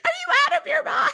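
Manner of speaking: high-pitched, rapid mock screech; imitated scream. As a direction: imitate her screaming at him in disbelief. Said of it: "just screaming at him like".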